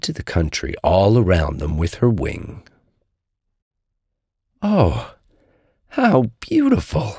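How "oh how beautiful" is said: high, slow wonder; high-pitched, marvelling little tiger; awed cry. A narrator's reading. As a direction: gasp out the delight, drawn out and high. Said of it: "cried Little Tiger".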